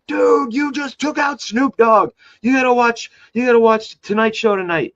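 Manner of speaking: high, loud, buzzing with news; hyped phone call; excited. Retold or imitated. Calling his brother about [name], high and excited.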